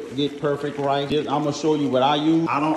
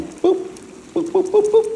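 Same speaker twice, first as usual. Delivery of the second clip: high playful boops; 5 dB louder than usual. Pretend. Playful sound while adding garlic.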